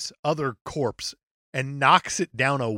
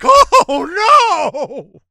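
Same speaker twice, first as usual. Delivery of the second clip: high, loud terrified shriek; panicked scream; 13 dB louder than usual. Retold or imitated. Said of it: "they're like".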